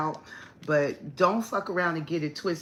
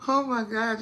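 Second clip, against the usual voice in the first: ordinary talk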